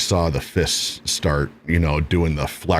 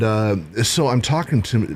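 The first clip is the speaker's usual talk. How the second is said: ordinary talk, nothing marked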